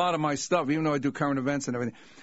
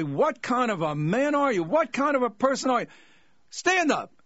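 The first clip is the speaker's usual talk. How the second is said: rising, higher, indignant challenge; mock indignant emcee; 8 semitones higher than usual. Buster scolding the heckler from the stage, rising mock outrage. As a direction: call him out, building to stand up.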